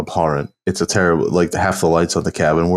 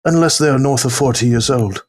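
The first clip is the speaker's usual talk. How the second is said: lifted, hushed wildlife narrator; nature documentary narrator; 8 semitones higher than usual. Retold or imitated. Continuing the nature documentary bit.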